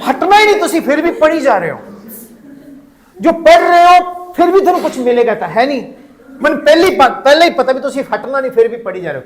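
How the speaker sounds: high, rapid Punjabi scolding; mock exasperation. Trainer reciting his prank essay to its reader, mock exasperation. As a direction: scold the reader who will not stop.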